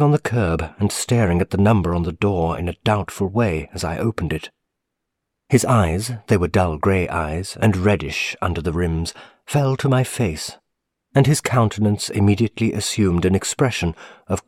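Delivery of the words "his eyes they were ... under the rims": ordinary delivery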